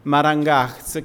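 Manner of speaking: marangach said slowly, syllable by syllable; careful pronunciation. Shown as a demonstration. Teacher introduces the word marangach slowly to students. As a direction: draw out marangach slowly for the class. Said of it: "la palabra que viene después".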